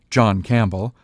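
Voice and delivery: usual speaking voice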